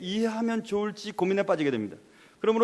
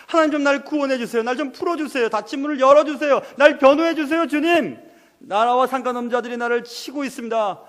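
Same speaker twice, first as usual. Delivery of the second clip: loud, high, urgent pleading; impassioned, raised-voice supplication; anguished prayer; 6 semitones higher, 5 dB louder than usual. Acted. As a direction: cry out to God as a hunted David. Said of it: "너무 마음이 아파서 그렇게 기도하고".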